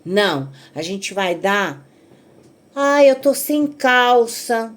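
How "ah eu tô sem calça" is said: higher, louder, slow whine; whiny granddaughter. Retold or imitated. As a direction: drag it out like a sulking kid. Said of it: "A história". (neta choramingando devagar, mais aguda e alta)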